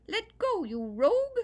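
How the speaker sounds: very high, loud tiny-man yell. Acted. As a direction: bellow down the hole at the rabbit. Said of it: "the little man yelled down the hole".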